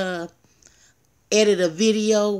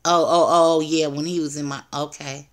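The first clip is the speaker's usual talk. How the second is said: ordinary talk, nothing marked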